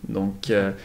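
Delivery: ordinary talk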